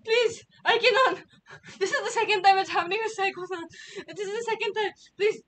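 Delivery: high, loud dismay; panicked pleading. Real, not acted. Streamer reacting to the mishap happening a second time, panicked. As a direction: plead urgently, voice jumping up.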